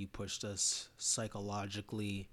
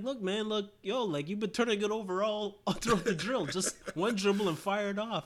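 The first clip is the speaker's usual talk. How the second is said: high, louder mock coach voice; coach instructing; 10 semitones higher, 7 dB louder than usual. Coach telling Darnell to take one dribble, high mock lecture. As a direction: lay down the rule with a laugh. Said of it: "And you're like".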